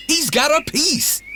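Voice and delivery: high, panicked donkey squeal; alarmed donkey. A narrator's reading. Donkey panicking at the cat's sword, high. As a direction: warn in a panic.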